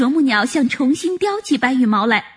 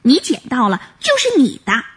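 usual speaking voice

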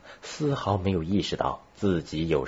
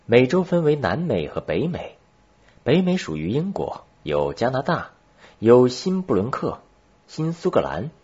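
higher-pitched pupil voice listing places; pupil reciting geography; 6 semitones higher, 5 dB louder than usual. A narrator's reading. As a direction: recite the lesson like a schoolchild.

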